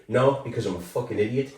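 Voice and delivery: ordinary talk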